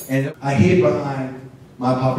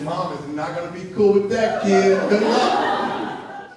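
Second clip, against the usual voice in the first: laughing; 7 semitones higher, slower than usual. Retold or imitated. Sister teasing, laughing.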